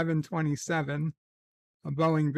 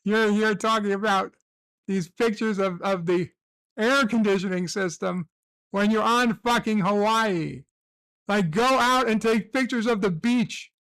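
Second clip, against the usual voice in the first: mocking rant; 4 semitones higher than usual. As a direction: tear into him like a scornful forum crowd. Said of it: "They're like".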